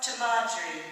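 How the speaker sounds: loud, firm toast. A narrator's reading. Landlord toasting friend's late mum, loud and hearty. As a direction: propose it to the whole bar. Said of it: "Jamie lifted his glass".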